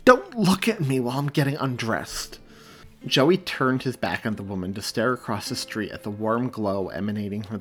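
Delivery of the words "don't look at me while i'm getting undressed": demanding. A narrator's reading. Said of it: "she demanded".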